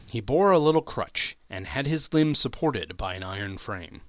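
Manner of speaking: ordinary delivery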